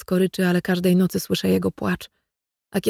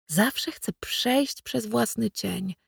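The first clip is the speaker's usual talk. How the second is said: slow breathy whisper; barely voiced, drawn-out whisper; 3 semitones higher, 6 dB quieter, much slower than usual. A narrator's reading. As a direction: hush it as a secret to yourself. Inner longing, hushed confession.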